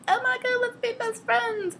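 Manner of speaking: squeaky, very high mock gushing; gushing excitement. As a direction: imitate an over-eager new best friend. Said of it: "really excited to meet her".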